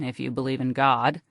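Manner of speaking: plain reading voice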